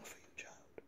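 breathy whispered afterthought; whispered aside. Real, not acted. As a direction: drop to a whisper on the last word. Tender aside at rant's end.

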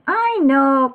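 high, drawn-out "I know" cry. A narrator's reading. Froggy answers his father's reminder, crying out. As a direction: call it out high and impatient-to-help. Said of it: "cried Froggy".

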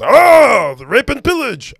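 high, loud, hammed-up Nordic accent; a clumsy stab at a Nordic accent; bad Nordic accent. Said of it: "I don't even know how to do, like, a Nordic accent".

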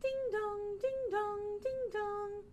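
high sing-song ding dong; doorbell chime. Pretend. Teacher imitates a doorbell.

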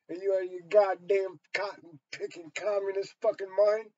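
ordinary talk

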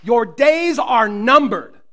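slow, loud, menacing threat; ferocious warning. Acted. Jesus to the demon storm, slow loud menace. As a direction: spell out each word like a sentence passed. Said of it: "with a ferocity that communicates".

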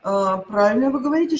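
usual speaking voice

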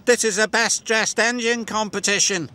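high-pitched engine character voice; James's voice. A narrator's reading. James telling Gordon about the decorations, high character voice. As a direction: pitch it well above the narrator. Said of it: "said James".

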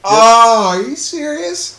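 high, loud, drawn-out incredulity; stunned protest, stretched out slowly; loud disbelief. Real, not acted. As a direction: cut in with an outraged, high-pitched cry. Interrupts friend in mock outrage.

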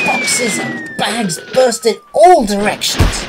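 high, loud burst of drama; dramatic excitement. A narrator's reading. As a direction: throw it out like a crash. Crash climax of story.

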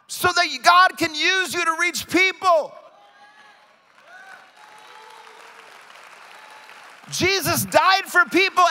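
high, loud rallying exhortation; rally the congregation at full force, stretching key words; impassioned preaching. Real, not acted.

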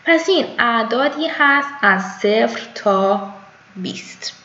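normal talking voice

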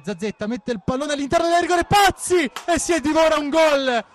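rising to a shouted miss; excited commentary. Real, not acted. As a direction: builds from calm to a yell. Missed goal chance buildup.

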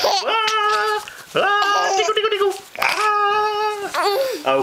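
high squealing tickle cries; playful squeal. Pretend. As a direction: shriek playfully while tickling. Tickling game with baby.

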